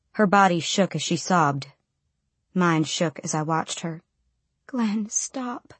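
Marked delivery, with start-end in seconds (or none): 4.7-5.7 high, soft, anguished plea; weak plea. A narrator's reading. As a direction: plead with him to stop. Mother pleads with him, sobbing.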